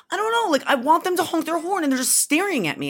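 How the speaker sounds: daughter's voice. Retold or imitated. Daughter explaining the truck honk, a high childish whine. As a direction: mimic an eight-year-old, lightly. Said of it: "She's like".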